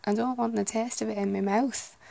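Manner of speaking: lightly done Lancashire voice with a flat A in "taste"; a hint of Lancashire accent. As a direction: put on a northern Lancashire grumble. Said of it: "supposed to be somewhere in Lancashire".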